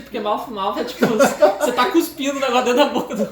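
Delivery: giggling as the embarrassing meaning lands; laughing. Real, not acted. Laughing while retelling the mistake. (rindo ao contar o mico)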